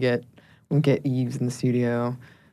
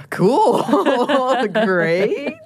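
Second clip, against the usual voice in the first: awkward laughing thanks; 8 semitones higher than usual. Retold or imitated. Podcaster reacting to being told her voice induces sleep, awkward laughing thanks. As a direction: laugh awkwardly while feigning gratitude. Awkward reply to odd compliment.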